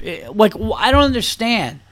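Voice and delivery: usual speaking voice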